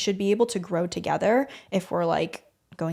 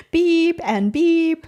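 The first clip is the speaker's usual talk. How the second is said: slow drawn-out beep sounds; mock censor beep; 9 semitones higher, 6 dB louder, much slower than usual. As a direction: say it like a bleep. Censoring a name playfully.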